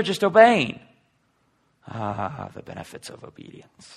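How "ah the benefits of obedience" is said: whisper. Real, not acted. Wry aside on obedience.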